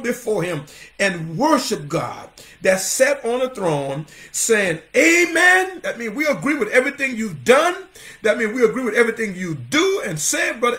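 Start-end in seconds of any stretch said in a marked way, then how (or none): 5.0-5.8 high-pitched, exultant amen; ringing amen. Retold or imitated. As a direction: call it out high like a worshipper. Quoting worshippers before the throne.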